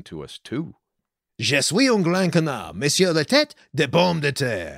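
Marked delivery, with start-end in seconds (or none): 1.4-4.8 clumsy, hammed-up French accent; mangled French phrase, said with gusto; bad French. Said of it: "I'm trying to speak French".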